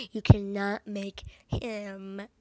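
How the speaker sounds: slow, word by word, long held DIE; dramatic command. Acted. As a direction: stretch the last word out dramatically. Acted plea to save Flynn.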